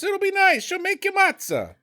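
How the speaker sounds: high, sing-song mom voice; mock doting mom. A doting mother offering matzah, high and sing-song. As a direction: coax them over like a fussing mother. Voicing a mom inviting guests.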